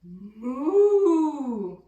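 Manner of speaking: loud drawn-out cow bellow; bellowing moo. A narrator's reading. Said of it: "bellowed Belinda".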